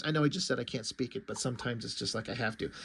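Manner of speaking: ordinary talk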